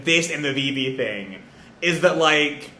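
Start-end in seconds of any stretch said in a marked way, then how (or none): none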